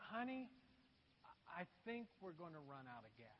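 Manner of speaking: husband's quiet confession to his wife; sheepish confession. Retold or imitated. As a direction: break it gently to your wife. Confessing to wife about gas.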